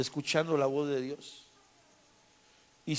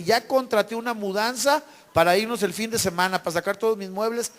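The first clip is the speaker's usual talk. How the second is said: raised, higher-pitched pleading complaint; urgent protest; 5 semitones higher, 4 dB louder than usual. Retold or imitated. Seller explaining movers are already hired, acted urgent protest. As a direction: imitate an upset seller pressing his case.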